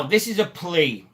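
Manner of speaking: normal talking voice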